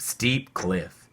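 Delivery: usual speaking voice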